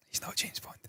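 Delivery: whispered verdict, barely voiced; a conspiratorial whisper to the viewer. Real, not acted. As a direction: breathe it out as a secret. Final whispered verdict on the actor.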